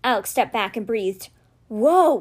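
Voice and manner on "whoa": amazed. Acted. Amazed at spell working.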